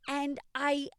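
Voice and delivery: ordinary delivery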